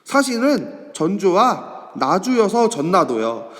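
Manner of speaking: normal talking voice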